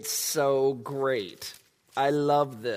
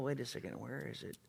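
ordinary delivery

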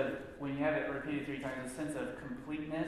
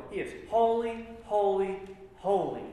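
each holy higher and louder, then falling; emphatic triple holy; 6 semitones higher, 5 dB louder than usual. Shown as a demonstration. Teacher showing threefold emphasis, louder. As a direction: build the holy, holy, holy with force. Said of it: "This emphasizes the degree".

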